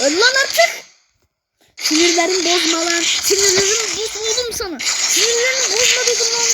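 snapping at the enemy, getting angrier each time; frustrated gamer rage. Real, not acted. Gamer yelling at the enemy that won't die, rising rage.

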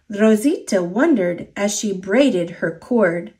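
ordinary delivery, nothing marked

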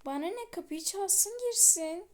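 put-on exasperated girlfriend raising her voice; annoyed outburst. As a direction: fire it back in irritation. Girlfriend snaps back, annoyed.

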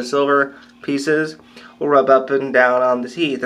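talking with finger in mouth; lip pulled with finger. Real, not acted. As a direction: lip held back showing teeth. Seen 2: Fingers pull lip back to show dental clamps; hand drops briefly near 3s.

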